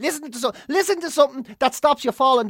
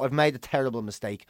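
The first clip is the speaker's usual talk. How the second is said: murmured under the breath, softer and lower; quiet regret; 8 semitones lower, 5 dB quieter than usual. Retold or imitated. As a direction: mutter it to yourself, mortified. Retelling regret at a bad outing.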